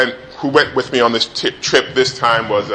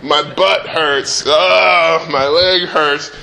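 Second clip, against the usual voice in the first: higher mock whine, drawn-out oh's; lightly hammed-up whining child; whining kid; 5 semitones higher, much slower than usual.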